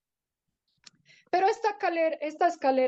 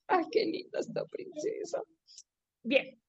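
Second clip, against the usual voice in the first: soft, voiceless whisper of admiration; hushed, doting aside; 3 semitones higher, 7 dB quieter than usual. Real, not acted. Breathless aside to a child.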